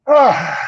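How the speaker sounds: low fake yawn; yawn sound. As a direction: let out a lazy yawn. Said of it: "And I go".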